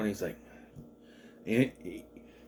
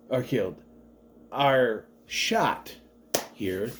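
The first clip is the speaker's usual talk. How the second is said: usual speaking voice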